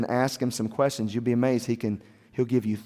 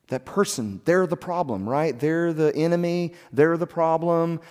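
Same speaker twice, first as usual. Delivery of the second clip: higher, emphatic mock accusation; insistent blaming; 6 semitones higher, 3 dB louder than usual. Imitating people who blame the other guy, insistent. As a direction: pin the blame on someone, voice raised in pitch.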